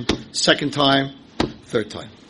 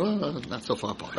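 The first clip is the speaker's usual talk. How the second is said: muttered aside; 2 semitones lower, 12 dB quieter than usual. Pretend. Imagined audience quip.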